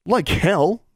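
high, sharp refusal; angry snap. A narrator's reading. David refuses to let the girls go, angry snap. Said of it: "David snapped".

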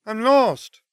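put-on very high girl's character voice; Luna, lost. A narrator's reading. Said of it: "Luna's voice was fainter this time".

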